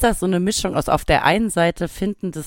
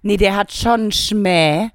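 put-on Viennese accent; 3 semitones higher than usual. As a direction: do a Viennese local. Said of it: "Die würden sagen". (Wiener Akzent)

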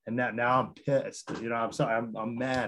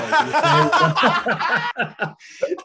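a squealing laugh, much higher; high laughing burst; high burst of laughter; 8 dB louder than usual. Real, not acted. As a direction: crack up high and loud. Laughing at his own story.

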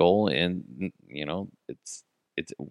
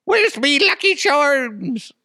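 much higher, louder, slow cartoon leprechaun; 7 dB louder, slower than usual. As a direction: squeal it like a cereal-box leprechaun. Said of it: "I kinda sound like".

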